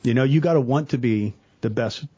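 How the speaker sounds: ordinary talk, nothing marked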